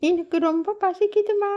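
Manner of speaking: put-on high, small child's voice begging for food; little girl Meenu. Hungry daughter asking her mother for food, high child voice.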